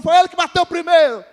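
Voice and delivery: put-on child's excuse. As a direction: plead like a child caught fighting.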